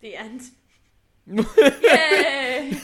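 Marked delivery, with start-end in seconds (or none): 1.3-2.7 loud laughing yay; excited cheer. Real, not acted. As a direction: cheer loudly through laughter. Cheers end of silly story.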